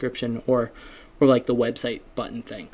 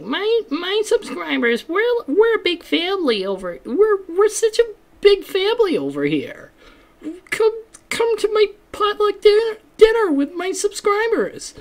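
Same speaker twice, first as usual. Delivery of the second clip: very high, syrupy fake-sweet YouTuber voice; mock YouTuber. Mocking how every YouTuber talks to subscribers, very high and sugary. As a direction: gush like an over-the-top influencer. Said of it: "every YouTuber's like".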